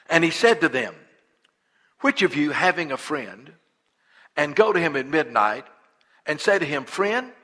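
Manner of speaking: solemn, measured Bible reading; scripture reading. Acted. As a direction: read the verse with weight. Reads scripture aloud.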